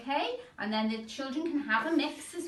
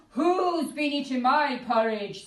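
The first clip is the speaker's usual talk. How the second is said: Daddy Bear; 5 semitones higher, 6 dB louder than usual. Acted. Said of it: "said Daddy Bear".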